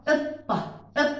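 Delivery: ordinary talk, nothing marked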